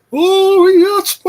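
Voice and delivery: very high, loud wailing Oh, yes; shrill mock wrestling manager wail; Paul Bearer impression. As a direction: shriek it like a ghoulish manager. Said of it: "can anybody do Paul Bearer?".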